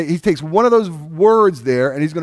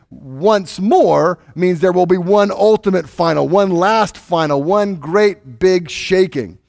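slow, louder build on "one great big shaking"; building emphasis; 4 semitones higher, 4 dB louder, slower than usual. Acted. Pastor stressing the final shaking to the congregation, slow and emphatic. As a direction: lean into each word of the climax, slowing down. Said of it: "emphasizing the word once".

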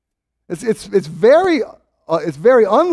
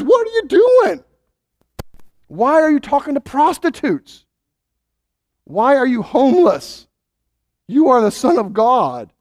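rising protest, more and more incredulous; exasperated prisoner's complaint; 3 semitones higher than usual. Retold or imitated. Imprisoned John complaining to Jesus.

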